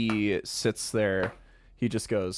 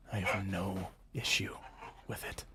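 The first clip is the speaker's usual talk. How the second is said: hushed finality; 9 dB quieter than usual. Acted. Sadist ends firmly, softly.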